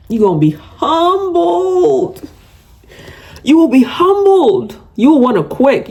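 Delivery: high, slow, ominous warning; slow high-pitched mock-ominous warning; dramatic warning. Real, not acted. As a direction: drag it out like a prophecy of doom.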